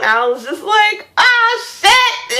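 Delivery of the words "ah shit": much higher, frantic delivery; high alarmed cry. Retold or imitated. Retelling the monster shrugging off her spell, high alarmed cry. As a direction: blurt it out, caught off guard.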